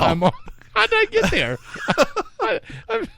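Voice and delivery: high, laughing through the words. Real, not acted. Host laughing at his own dark joke about his daughter, high and giggly. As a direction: giggle it out in disbelief.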